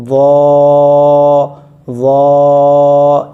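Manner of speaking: وا held long and loud, tongue at root of front teeth; heavy ظا. Shown as a demonstration. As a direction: stretch وا heavy from the tooth roots. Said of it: "یہاں لگے گا دانتوں کی جڑ میں".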